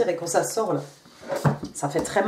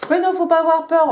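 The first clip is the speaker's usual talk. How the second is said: higher, louder protest to reassure; emphatic reassurance; 8 semitones higher, 5 dB louder than usual. Real, not acted. Card reader brushing off the fear of confessing, emphatic reassurance. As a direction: exclaim it to chase away the fear.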